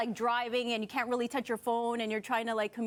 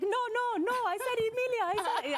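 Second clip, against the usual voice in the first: imitating dad snapping; 11 semitones higher than usual. Dad snapping at Siri who misheard the name, mock exasperated. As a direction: play the fed-up dad barking corrections at Siri. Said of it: "and he snaps".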